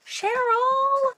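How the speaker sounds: overjoyed cry of the name; excited shout. Real, not acted. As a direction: call out her name in pure delight. Thrilled at a friend's gift.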